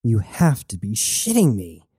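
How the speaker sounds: plain reading voice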